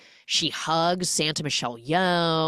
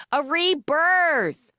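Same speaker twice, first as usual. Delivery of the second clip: much higher, slow, sung-out announcement; grand tagline; 11 semitones higher, 4 dB louder, much slower than usual. Pretend. Host belts the tagline, grand and stretched out. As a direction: stretch it out like an epic trailer. Repeating the tagline a third time.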